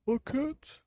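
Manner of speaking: high, loud gasp of relief; gasp, then exclaim high; old man gasping. Acted. Old man finding a cat at last, high relieved voice. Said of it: "he thought to himself".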